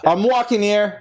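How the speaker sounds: high, brash New Yorker; New York accent. Retold or imitated. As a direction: shout it like a cabbie in New York. New York impression after Boston.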